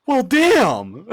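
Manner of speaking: high, loud exclamation of disbelief; loud shocked outburst. Real, not acted. Streamer reacting to a chat insult, loudly shocked. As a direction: burst out, stung and amused.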